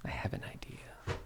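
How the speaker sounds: whispered, no voice at all; conspiratorial whisper to the party. Real, not acted. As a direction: lean in and whisper the plan. Secret plan to party.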